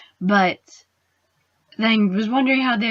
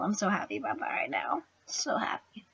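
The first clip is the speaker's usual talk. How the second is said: whisper; 11 dB quieter, faster than usual. Real, not acted. Gleeful hushed aside.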